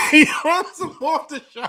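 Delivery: loud laughing disbelief; talking through big laughs. Real, not acted. Co-host laughing at the dropped call.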